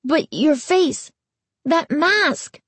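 high, slow nervous stammer. A narrator's reading. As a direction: falter out the words. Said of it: "Noah stammered".

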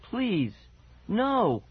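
panicked wail climbing with each no. A narrator's reading. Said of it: "she wailed".

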